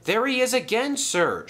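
high-pitched, excited call-out; shouted. A narrator's reading. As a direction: call out a sighting to your boss. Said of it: "shouted Thomas".

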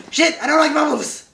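high, loud cartoon panic at bubbles; mock panic. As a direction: yelp it like a scared kid. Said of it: "Like, he sees a bubble".

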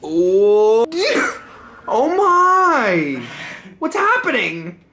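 startled and laughing. Real, not acted. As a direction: react to a sudden blast of air. Fan blast startles him.

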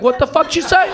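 high, loud challenge; acted indignant comeback; mock confrontation. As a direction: fire back at a catcaller. Acted woman confronting a catcaller.

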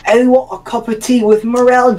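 quick, high, hammed-up British; British accent. Gamer joking about a cup of tea, mock British accent. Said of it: "start doing a British accent".

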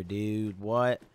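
ordinary delivery

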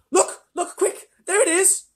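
very high, loud urgent cry; excited child. A narrator's reading. Child spots the animal and alerts the others, high excited cry. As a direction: call them to look at once.

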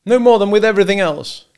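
high, loud, bitter answer; angry bitterness. A narrator's reading. Husband to hunter over breakfast, bitterly. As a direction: spit out the bitter reply.

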